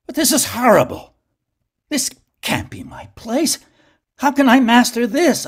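high, loud frantic begging; a terrified plea that cracks upward; desperate pleading. Acted. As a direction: beg for mercy, voice climbing. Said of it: "I pleaded".

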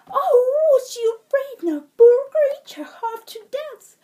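high, loud, panicky little-man voice; frightened leprechaun cry. Acted. Caught leprechaun pleading with Pet, high frightened cry. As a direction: squeal it like a caught fairy in terror. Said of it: "cried the leprechaun".

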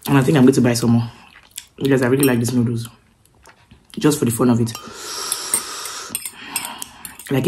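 eating between words; chewing while talking. Real, not acted. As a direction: mouth partly full of noodles. Seen 4: Holds noodles on chopsticks over bowl, lips pursed, chewing between words.

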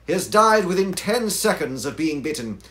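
loud, high, urgent exclamation; crying out. Acted. Holmes explains the serpent to Watson, crying out. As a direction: call it out forcefully, high-pitched. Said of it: "cried Holmes".

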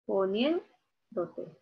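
Poniéndote very slowly split, pause after NIÉN; stressed syllable. Shown as a demonstration. Teacher showing students where the force falls, slow split Poniéndote with stressed NIÉN. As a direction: hold NIÉN in Poniéndote, then finish. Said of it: "Aquí, ¿verdad?".

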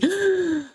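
sharp shocked gasp, pitch jumping up. Real, not acted. Reacting to the classmate being hurt, a shocked gasp. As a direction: catch your breath in shock.

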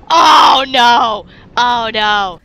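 loud drawn-out cry of alarm; sudden loud dismay; alarmed shout. Real, not acted. Sudden mishap in the game.

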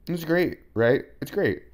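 sarcastic. Acted. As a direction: say it with a sarcastic grin. Sarcastic praise of Elon.

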